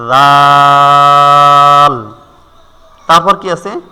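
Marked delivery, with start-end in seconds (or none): 0.1-2.1 tongue at tooth tips, vowel held long; drawn-out dhal. Shown as a demonstration. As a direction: stretch it on one steady, level note. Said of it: "আগার সঙ্গে লাগাইয়া".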